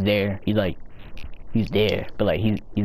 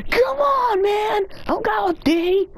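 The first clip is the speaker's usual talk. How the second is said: high wailing complaint, much higher; much higher, whining outburst; frustrated cry; slower than usual. Real, not acted. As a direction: cry out in exasperation. Frustrated outcry in game.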